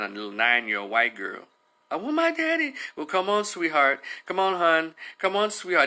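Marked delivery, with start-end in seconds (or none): 1.9-2.7 put-on high-pitched child voice, not shouted; imitated child's plaintive whine; pleading little girl. 2.9-5.9 higher-pitched coaxing; imitated indulgent officer soothing a girl; softer, babying cop. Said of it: "They would have been a little softer".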